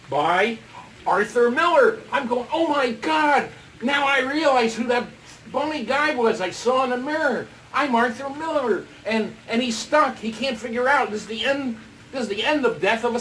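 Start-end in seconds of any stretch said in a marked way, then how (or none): 2.5-8.9 loud, raised, astonished exclaiming; thrilled "oh my God" outburst; amazed realization. Retold or imitated. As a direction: exclaim it like a stunning revelation. Amazed at discovering his identity.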